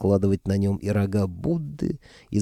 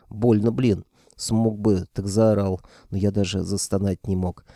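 usual speaking voice

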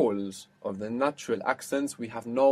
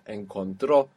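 Encontró with the weight on TRÓ; final-syllable stress; 3 semitones lower than usual. Shown as a demonstration. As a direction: land hard on the last O of Encontró. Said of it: "are gonna have that accent".

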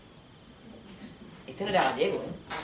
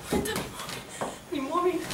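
small, high frightened voice; high anxious murmur to yourself; acted panic; 10 semitones higher, 6 dB quieter, faster than usual. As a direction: search helplessly in a panic. Frightened search for ringing phone.

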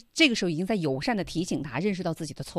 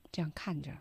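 soft whispered aside; whispering; 5 semitones lower, 11 dB quieter, faster than usual. Real, not acted. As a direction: drop to a whisper.

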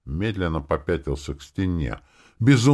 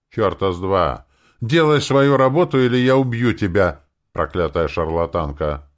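angry threat; 2 semitones higher, 5 dB louder than usual. Acted. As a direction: threaten her with rising temper. Narrator acts furious threat to woman. (гневно, с угрозой)

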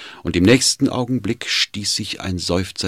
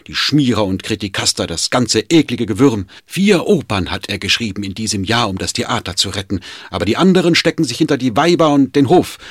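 loud, high, fast bitter tirade; distraught outburst; 4 semitones higher, 5 dB louder than usual. Acted. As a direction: rail against them in despair. Servant rails at master's tormentors.

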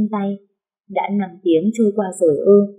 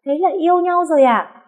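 shocked shout; 11 semitones higher than usual. A narrator's reading. An shouts in shock at Sam about dating. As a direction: yell it right in her friend's face. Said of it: "hét to vào mặt tôi".

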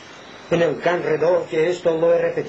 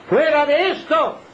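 high, ringing cry; high-pitched emphatic outburst; impassioned preaching; 10 semitones higher, 4 dB louder than usual. Real, not acted. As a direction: proclaim it forcefully from the pulpit. Preacher cries out repeated phrase.

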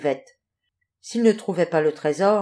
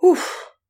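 high, loud sigh of relief "Ouf"; relieved puff; 10 semitones higher, 5 dB louder than usual. Acted. Pelican after the storm landing, puffing with relief. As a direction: blow out the "Ouf" like a narrow escape. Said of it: "Grand-Bec souffla".

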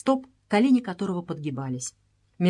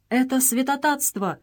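higher-pitched cry of protest; outraged cry; 5 semitones higher than usual. A narrator's reading. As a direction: cry out at the sacrilege. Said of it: "вскричал Казиль". (возмущённый вскрик)

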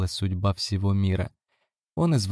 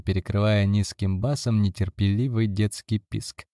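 ordinary delivery, nothing marked

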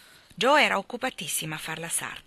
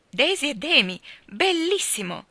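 delighted exclaim; 8 semitones higher, 5 dB louder than usual. Acted. Joe delighted by Laurie's baby names, high delighted cry. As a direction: gush like you're clapping your hands. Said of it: "esclamò Joe battendo le mani".

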